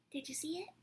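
plain reading voice

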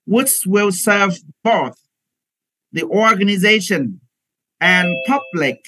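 slow, careful phrase-by-phrase reading aloud; slow model reading. Shown as a demonstration. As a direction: read it slowly in short chunks for students. Teacher reads passage as model. (giáo viên đọc mẫu chậm từng cụm)

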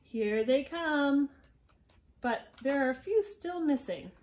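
ordinary delivery

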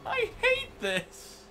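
very high mock despair; high, hammed-up lament; Don Knotts. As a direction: whimper as a miserable nervous comic. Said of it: "I think Don Knotts is".

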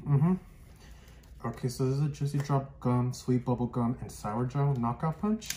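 muffled behind bandana; through a face mask. Real, not acted. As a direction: cloth covering mouth. Seen 0: Bandana covers mouth throughout; chewing can't be seen, only candy in hand.

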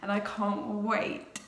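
usual speaking voice

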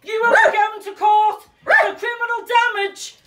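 slow, loud furious shouting at officers; real outrage, shouted; angry shout. Real, not acted. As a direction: yell every word out, slow and hard. Woman yelling at arresting police.

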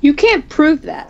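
loud, high protest. Acted. Arguing with his double, a loud defiant protest. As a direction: push back hard at the claim. Heated argument.

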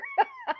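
very high, soft giggle; giddy giggle. Real, not acted. Host needs water after swooning, giddy giggle. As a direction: giggle helplessly, high and light.

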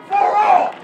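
forceful, projected finish; rousing climax. Real, not acted. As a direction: ring it out to the whole crowd. Climax of pledge to crowd.

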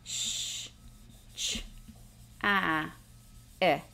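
each sound alone, spaced out with pauses; isolated phonics sounds. Shown as a demonstration. Teacher modelling the separate sounds while students erase. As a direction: sound out Sh, ch, ah, I one by one slowly. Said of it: "say the sounds again".

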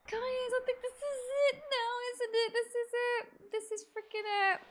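high, whiny near-tearful awe; emotional anticipation. Real, not acted. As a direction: voice lifted up, overwhelmed that the end is here. Reaches the final moment.

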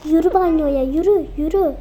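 mother shouting at daughter; frantic orders. Acted. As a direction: hurry her into the bathroom, panicked. Mother ordering child to bathroom.

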